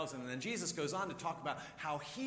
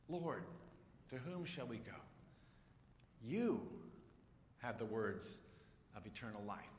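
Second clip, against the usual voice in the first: reverent quote; 5 semitones lower, 7 dB quieter than usual. Real, not acted. Preacher quoting Peter to the congregation, soft and reverent. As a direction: let it land quietly, with awe.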